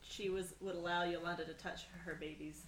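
normal talking voice

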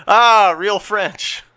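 milk the ad line like the spooked narrator; Orson Welles bit. Retold or imitated. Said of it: "terrified of the French".

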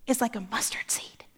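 sudden hushed whisper. Acted. Preacher undercutting the grand buildup, whispered. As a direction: drop to a whisper for the letdown.

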